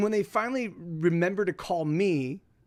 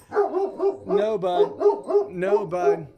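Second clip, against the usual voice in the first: calling off dog; 2 semitones higher, 6 dB louder, much slower than usual. Real, not acted. Streamer interrupting himself to correct his dog, loud. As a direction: cut in firmly over the noise.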